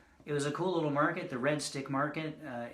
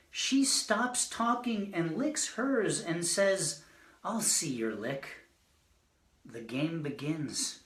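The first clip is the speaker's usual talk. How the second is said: higher-pitched recital, easing down at the close; poetry reading; 6 semitones higher, slower than usual. Acted. Poet reading a mug-licking poem to an audience, measured recital. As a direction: recite it, then drop for the punchline. Reads own poem aloud.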